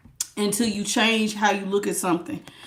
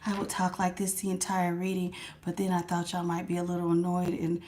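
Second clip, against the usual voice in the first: tiny, much softer mousy voice; mock-meek church mouse talk; 4 semitones lower, 10 dB quieter than usual. Shown as a demonstration. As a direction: shrink it to a timid little murmur. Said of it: "talking like a church mouse".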